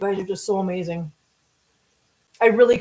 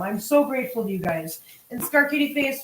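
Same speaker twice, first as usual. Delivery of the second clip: ordinary talk, nothing marked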